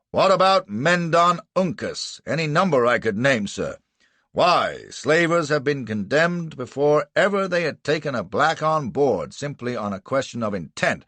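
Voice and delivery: bawled argument. A narrator's reading. Prosecutor cites precedents to the adjudicator, bawling. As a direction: argue at high volume, easing towards the end.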